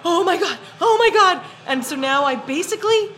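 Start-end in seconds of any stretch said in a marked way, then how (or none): 0.0-1.4 high, rising mock thrill; re-enacted delighted gasping exclamation; retold excitement. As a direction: relive the thrilled news, building higher and louder. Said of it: "And I was like".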